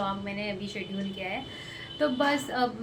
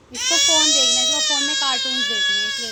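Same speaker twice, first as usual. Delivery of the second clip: raised voice over crying; 9 dB louder than usual. Real, not acted. Over crying child, explaining.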